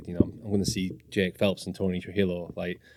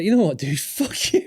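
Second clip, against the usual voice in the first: mock defiant; 10 semitones higher, 3 dB louder than usual. Confrontation retold.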